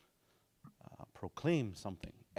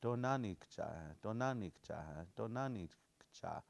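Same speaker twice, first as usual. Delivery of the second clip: slow, careful syllable-by-syllable "Donanikcha"; repeated slow "Donanikcha" practice; slow drill; 4 dB quieter, slower than usual. Shown as a demonstration. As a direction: drill "Donanikcha" slowly for learners. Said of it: "you're gonna have to really work on".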